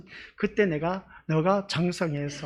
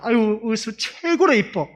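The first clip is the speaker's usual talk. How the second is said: higher, louder, slow doting praise; fond praise; 5 semitones higher, 6 dB louder, slower than usual. Retold or imitated. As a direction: gush over the little one. Praising the little girl fondly. (높고 느리게 귀여워하며 칭찬)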